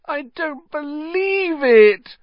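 high, slow, drawn-out wail. Acted. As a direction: stretch it into a miserable wail. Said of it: "Hermione wailed".

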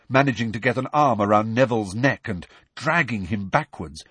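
usual speaking voice

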